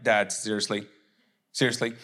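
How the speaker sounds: low, mock-embarrassed complaint; embarrassed son. Jesus to his Dad after public praise, embarrassed low protest. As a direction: grumble it low, like a son shown up by a parent. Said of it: "like, this Jesus, God, Dad moment".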